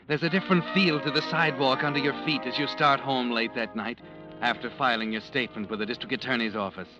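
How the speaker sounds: ordinary delivery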